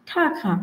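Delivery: "ঠা খা" with a puff on each syllable; airy consonants in "ঠা খা"; aspirated T and K. Shown as a demonstration. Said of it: "কে এর উচ্চারণ খ আসলে". ("ঠা খা", ঠ আর খ মহাপ্রাণ)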